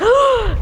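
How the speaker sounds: sharp frightened high gasp. Retold or imitated. Audience imagining a snake, gasping. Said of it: "you were like".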